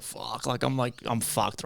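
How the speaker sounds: half-whispered panic under the breath; muttered to self. Retold or imitated. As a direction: hungover coming home, muttering to himself.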